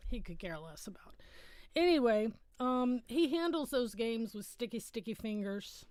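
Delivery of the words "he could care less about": whispered aside. Real, not acted. Muttered aside about son's disinterest.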